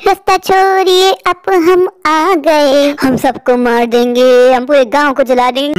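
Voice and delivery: slow, low menacing witch. Acted. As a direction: threaten to burn the village. Witch threatening the village.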